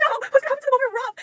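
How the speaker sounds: high, loud, fast panicked cry; panicked squeal. Retold or imitated. Reader to viewers, replaying her panic. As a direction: relive the panic of reading it. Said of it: "I was like".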